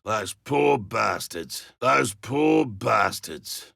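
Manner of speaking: grim sorrow. Acted. Hunter mourning the colonists he lost, soft and slow. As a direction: mourn the dead under your breath.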